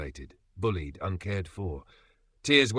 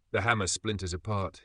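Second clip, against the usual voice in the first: ordinary delivery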